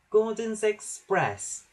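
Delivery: slow, high-pitched little engine; Percy puffing. A narrator's reading. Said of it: "puffed Percy".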